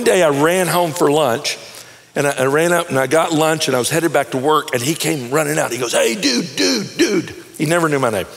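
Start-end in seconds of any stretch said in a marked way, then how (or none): 5.9-7.4 high, lazy dude voice calling out. Retold or imitated. Neighbor hailing him from the steps, high slacker call. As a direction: hail the neighbor in a slacker drawl.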